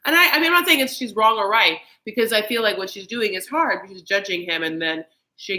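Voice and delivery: ordinary delivery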